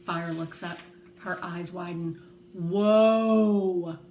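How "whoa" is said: drawn-out loud whoa of wonder; awed whoa. Acted. Girl amazed at big dogs, loud drawn-out whoa. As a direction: gasp it out, amazed.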